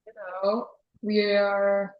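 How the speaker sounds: ordinary talk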